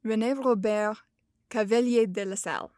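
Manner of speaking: "René Robert Cavelier de la Salle" with French R's in the throat; careful French "René Robert", stress on the last syllables; French pronunciation. Shown as a demonstration. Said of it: "So I'm gonna do my best".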